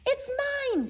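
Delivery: put-on a child's squeaky claim, shouted; squirrel shouting. Searle the squirrel grabbing for the pine cone, squeaky mock shout. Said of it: "shouted Searle".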